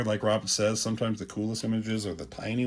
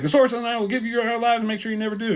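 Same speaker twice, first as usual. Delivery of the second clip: rushed heroic vow; 12 semitones higher, much faster than usual. Retold or imitated. He-Man vows revenge.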